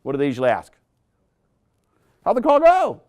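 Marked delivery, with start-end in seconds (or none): 2.2-3.0 bright, higher manager question; high eager manager voice; manager asking. Acted. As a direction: play the upbeat sales manager. Manager voiced in role-play.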